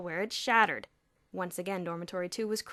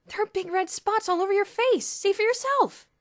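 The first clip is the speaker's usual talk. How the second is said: urgent; 12 semitones higher, 10 dB louder than usual. Acted. Boots fakes alarm at spots.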